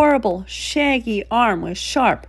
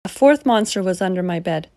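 usual speaking voice

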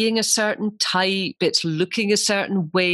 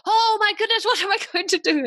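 high, fast panicky inner voice; sudden alarm, squeaky and rushed; panicked thought; faster than usual. Retold or imitated. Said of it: "I was in my posh voice and I thought".

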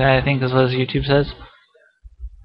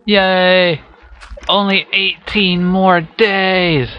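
excited; 6 semitones higher, much slower than usual. Real, not acted. Birthday excitement, slow stretched.

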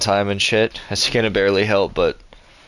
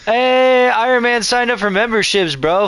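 excited shout; 12 semitones higher, 4 dB louder, slower than usual. Real, not acted. Thanking a member excitedly.

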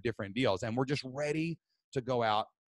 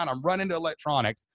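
ordinary talk, nothing marked

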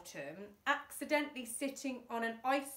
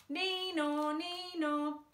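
high wailing nee-naw; siren imitation; 7 semitones higher, 3 dB louder, slower than usual. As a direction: sing out like a police siren.

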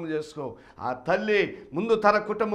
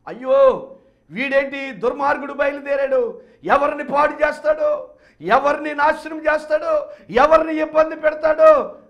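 loud, higher alarmed gossip; fearful townsfolk; 10 semitones higher, 12 dB louder than usual. Acted. Townspeople fearing the son, loud alarmed cry. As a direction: voice the scared crowd, louder and higher.

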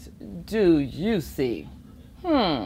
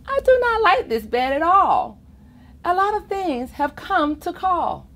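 Ned complaining; 10 semitones higher, 4 dB louder than usual. Acted.

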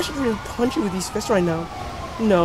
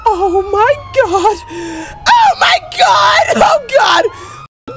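high, loud, slow wailing oh my God; panicked screaming at the others; 13 semitones higher, 6 dB louder, much slower than usual. Real, not acted. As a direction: shriek it like something grabbed you. Screaming in the game.

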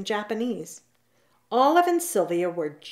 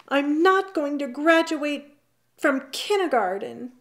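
higher, understated wail of distress; upset outburst; 7 semitones higher than usual. A narrator's reading. Yoko confessing to her mother, mildly upset outburst. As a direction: let the held-in worry out, pitched up. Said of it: "could hold it in no longer".

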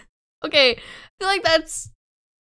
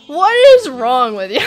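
louder, laughing disbelief; laughing, exasperated outburst; laughing shout; 9 dB louder than usual. Real, not acted. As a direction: yell it through laughter at the chat.

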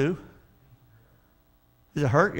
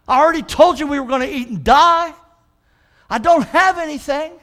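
higher, voiced indignant widow; 9 semitones higher, 3 dB louder than usual. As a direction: play the widow scolding the prophet.

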